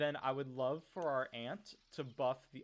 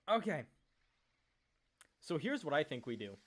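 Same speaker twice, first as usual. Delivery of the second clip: ordinary delivery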